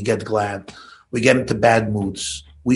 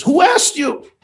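much higher, louder mock snap; imitating angry father; 12 semitones higher, 6 dB louder, slower than usual. Boy's father rounds on the mother, mock angry outburst. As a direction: throw it back at her, offended.

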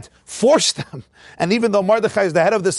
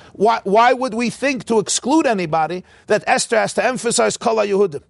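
usual speaking voice

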